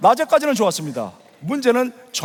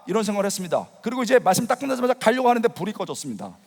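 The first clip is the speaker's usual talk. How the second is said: normal talking voice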